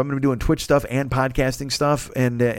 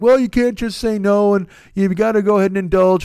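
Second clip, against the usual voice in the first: mocking his old self; 8 semitones higher, 5 dB louder than usual. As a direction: parody your own old excuses, pitched high. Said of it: "I'm like".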